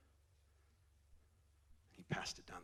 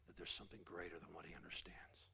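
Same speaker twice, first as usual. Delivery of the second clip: whisper; 5 semitones lower, 10 dB quieter than usual. Real, not acted. Preaching hush.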